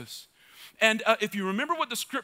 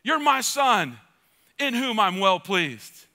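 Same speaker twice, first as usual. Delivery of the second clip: proclaiming God's words; 5 dB louder, much slower than usual. Retold or imitated. Preacher voicing God to Mike, ringing proclamation. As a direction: call his name out. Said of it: "Church, it changes everything".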